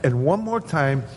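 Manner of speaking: ordinary delivery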